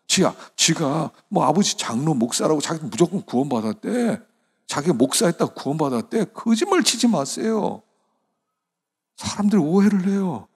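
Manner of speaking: full-force sermon rebuke; fiery preaching. Real, not acted. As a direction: preach it hard, with rising indignation.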